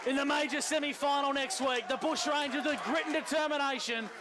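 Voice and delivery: calling the result over the crowd; live sports call. Acted. Live match call by commentator.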